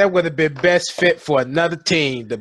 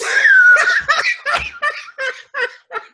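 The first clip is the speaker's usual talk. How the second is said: laughing shout. Real, not acted. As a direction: howl with laughter. Laughs at team names.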